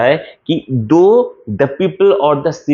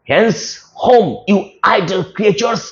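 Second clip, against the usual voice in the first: angry Flavius; 6 semitones higher, much slower than usual. Acted. Teacher reads Flavius's angry line.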